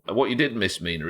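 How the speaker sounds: usual speaking voice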